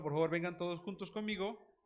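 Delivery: ordinary delivery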